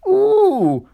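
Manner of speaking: very high, loud shriek; shocked scream. A narrator's reading. Offended coaches screaming at the rude engine. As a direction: shriek in outrage. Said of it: "screamed Agnes".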